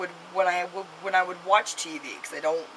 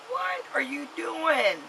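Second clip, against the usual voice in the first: high, drawn-out "what are you doing"; exasperated disbelief; 8 semitones higher, slower than usual. Retold or imitated. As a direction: retell it as an incredulous, high-pitched parental scolding. Retold scolding of her son.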